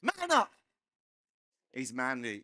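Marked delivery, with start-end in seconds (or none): 0.0-0.5 mock shout. Host chanting man up.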